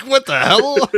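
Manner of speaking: laughing quote. Retold or imitated. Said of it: "she was like".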